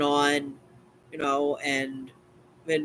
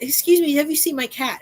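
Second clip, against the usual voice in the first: put-on dotty absent-minded old lady; 11 semitones higher, 4 dB louder than usual. Neighbor asks about her cat.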